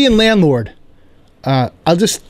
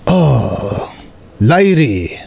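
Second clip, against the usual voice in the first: lightly voiced Korean accent, landlord greeting; a hint of Korean accent, calling the tenant; 6 semitones lower, much slower than usual. Landlord greets tenant at the door, light Korean accent. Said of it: "my Korean landlord".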